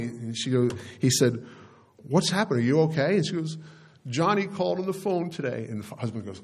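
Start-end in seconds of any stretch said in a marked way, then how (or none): 2.1-3.2 high-pitched, mildly alarmed questions; worried husband. Retold or imitated. Husband to crying wife at the door, worried. 4.1-5.7 high-pitched mock upset voice; imitated tearful mother. Crying mother telling husband of Johnny's call, mock tearful. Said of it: "And she was just sobbing".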